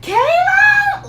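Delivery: high, loud calling shout; imitating mom shouting her daughter's name. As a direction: yell the name across the street like mom. Mom calling across the street.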